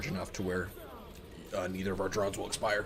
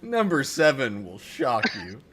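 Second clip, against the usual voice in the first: clickbait video narrator; 5 semitones higher, 9 dB louder, slower than usual. Pretend. Parodying clickbait video titles.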